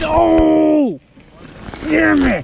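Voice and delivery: sudden high angry yell; frustrated shout. Real, not acted. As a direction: shout it out as the fish gets away. Loses a fish, frustrated outburst.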